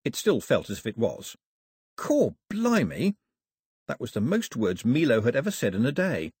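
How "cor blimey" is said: slow, high-pitched astonishment; drawn-out, squeaky exclamation of wonder; amazed exclamation. A narrator's reading. The astonished thought repeated.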